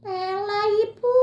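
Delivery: high singsong reply to mother; small voice, singsong. Acted. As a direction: answer sweetly in a high little voice. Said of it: "itu suara kecil".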